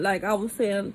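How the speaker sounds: ordinary talk, nothing marked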